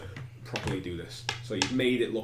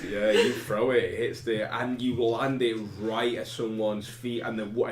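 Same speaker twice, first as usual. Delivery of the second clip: ordinary talk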